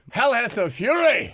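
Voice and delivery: high, loud whooping threat; mock fury shout. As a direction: yell it like a wrathful warning. Threatening no-shows on door list.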